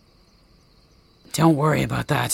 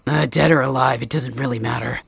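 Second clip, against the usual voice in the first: usual speaking voice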